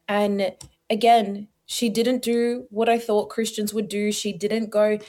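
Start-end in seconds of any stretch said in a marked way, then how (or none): none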